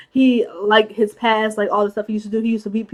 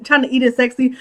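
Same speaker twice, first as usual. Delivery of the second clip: usual speaking voice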